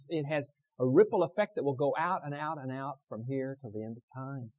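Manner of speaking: high, loud, fast exhortation; full force preaching. Acted. Preacher warning the congregation about sin, full-force preaching. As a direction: hammer the warning home.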